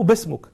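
بسمك said "basmuk", starting on b. Retold or imitated. Caliph asking the scholar's name, b for m in بسمك. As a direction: swap the m for b in بسمك. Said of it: "أبدل الميم باء".

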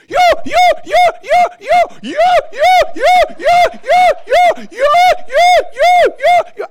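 high, piercing repeated whoops; shrieked falsetto "yo" over and over; imitated wailing yelps. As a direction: mimic her loud rhythmic yelping, way up high. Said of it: "she was literally rolling, uh, going".

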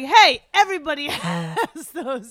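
slow, loud imitation with a laugh; loud mock retort. Voicing a cohost's reaction to hot dog boasting, loud mock retort. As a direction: call it out in teasing disbelief.

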